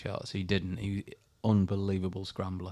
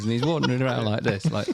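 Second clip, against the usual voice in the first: ordinary talk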